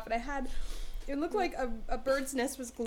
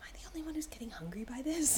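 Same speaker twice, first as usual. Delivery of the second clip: softer conspiratorial whisper; 7 dB quieter, faster than usual. Retold or imitated. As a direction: lean in and whisper it to a stranger. Secretly asking a stranger.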